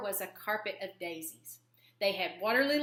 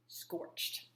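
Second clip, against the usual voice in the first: much softer breathy whisper on the word; 10 dB quieter than usual. A narrator's reading. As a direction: let it fade out like smoke. Wolves' tails get scorched.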